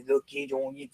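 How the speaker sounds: a soft, quiet hint of an old French accent on the nickname; lightly mimicking a friend's French-accented teasing; understated French accent, said under the breath; light French accent imitation. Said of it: "He does that old French accent when he says it".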